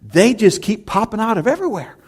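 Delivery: put-on high, loud, wide-eyed little girl voice; little girl's amazement. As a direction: mimic a small girl, astonished at all the angels. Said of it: "she says".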